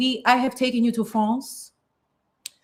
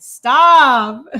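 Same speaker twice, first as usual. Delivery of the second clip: excited stop; 4 semitones higher, 12 dB louder than usual. Real, not acted. Gushing over nostalgic cigarettes.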